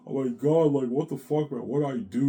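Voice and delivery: loud mock anguish; tearful pleading. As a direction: act out a distressed plea. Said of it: "I'm crying".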